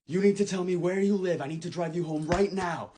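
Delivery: loud, high-pitched panicked demand; frantic yelling. Retold or imitated. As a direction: shout it urgently as if shaking someone awake. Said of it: "I start shaking this girl like".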